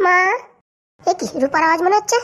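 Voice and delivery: ordinary talk, nothing marked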